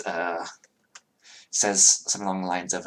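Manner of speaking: ordinary delivery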